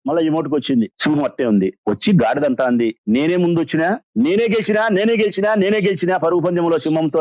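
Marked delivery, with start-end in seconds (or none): 3.1-7.2 higher-pitched, insistent victory claim without much extra volume; mock boastful crowing. Storyteller's donkey claims the race from the silent lion, mock crowing. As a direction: crow repeatedly like a self-declared winner. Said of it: "నేనే గెలిచినా అని చెప్పి గాడిద ఎంత కేకలేస్తాంది".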